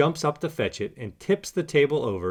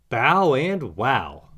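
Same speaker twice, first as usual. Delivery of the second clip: slow drawn-out exclamation; 4 dB louder, much slower than usual. Acted. Puppy's delighted exclamation.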